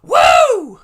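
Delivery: Caleb Reynolds woo. Retold or imitated. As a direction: belt the woo as high and loud as you can. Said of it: "let me try again".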